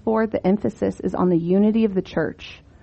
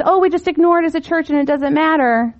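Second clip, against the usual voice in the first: pitched up, mock-casual and dismissive; mock careless quote; 7 semitones higher than usual. Speaker mocks a church shrugging off sin, mock-casual. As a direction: brush it off like it's nothing.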